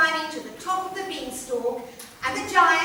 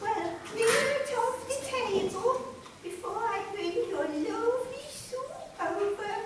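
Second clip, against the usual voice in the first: soft high housewife voice; gentle high-pitched wife; 7 semitones higher, 6 dB quieter than usual. Acted. Wife speaking to giant.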